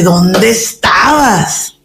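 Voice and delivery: very slow, tearful mock whine; whiny jealous wife. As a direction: drag it out like a weepy accusation.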